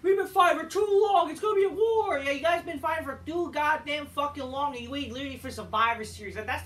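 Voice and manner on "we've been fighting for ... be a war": shouted, high, hammed-up wrestler promo; mock Sami Zayn. Vlogger mocking Sami Zayn's hyped War Games promo, loud and high. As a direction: bellow it like a wrestler hyping a fight. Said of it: "Sami Zayn is like".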